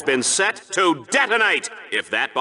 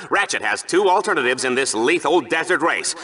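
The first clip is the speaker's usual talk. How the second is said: normal talking voice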